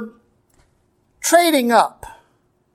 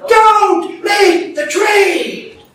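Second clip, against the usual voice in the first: high, slow, full-force shout; angels yelling; 10 semitones higher, 5 dB louder, much slower than usual. Acted. Angels warning Galatians against swapping grace, drawn-out yell. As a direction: shout each word as an urgent warning. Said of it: "were yelling".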